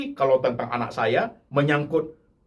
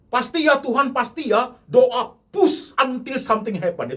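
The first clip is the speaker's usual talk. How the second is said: mock pushy prayer; 8 semitones higher, 4 dB louder than usual.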